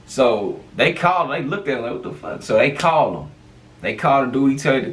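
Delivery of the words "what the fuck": breathy whisper, no voice; whispered what the fuck. Retold or imitated. Whispering to his friend as the cops light them up. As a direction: whisper it in alarm. Cops' reaction.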